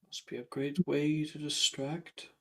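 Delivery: muttered. Acted. Ghost commenting on the school tournament, muttered. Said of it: "He mutters".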